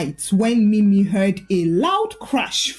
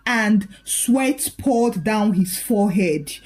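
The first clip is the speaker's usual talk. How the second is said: usual speaking voice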